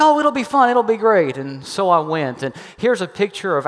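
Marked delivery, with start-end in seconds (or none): 0.0-1.4 put-on cheery girlfriend. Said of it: "She's like".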